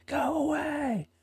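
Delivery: high, drawn-out mock scream, held back in volume; mock screaming wife. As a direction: imitate a woman screaming through a door, slowly. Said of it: "like freaking screaming".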